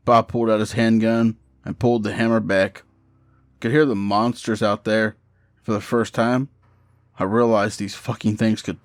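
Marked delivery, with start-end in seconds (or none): none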